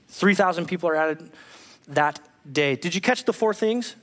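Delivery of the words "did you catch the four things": ordinary delivery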